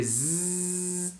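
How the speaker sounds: a buzzing "zzz" like a bee. Shown as a demonstration. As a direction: hum a bee's zzz through the teeth. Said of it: "comme l'abeille qui fait".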